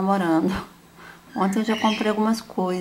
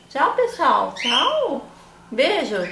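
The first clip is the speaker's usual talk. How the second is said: squeaky praising a dog; 6 semitones higher, 3 dB louder, much slower than usual. Real, not acted. Waving goodbye with the dog.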